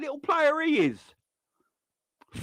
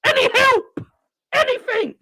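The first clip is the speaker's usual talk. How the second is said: desperate call; 6 semitones higher, 6 dB louder than usual. Retold or imitated. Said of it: "Konate's like".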